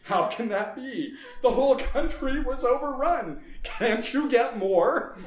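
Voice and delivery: shrill disbelief at the shortage; incredulous outburst. Acted. Councilman shocked the zombies are running out, incredulous outburst.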